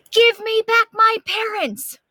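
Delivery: high, loud cry to the beans; shouting. Acted. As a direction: yell it up high for your parents. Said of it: "shouted Martha".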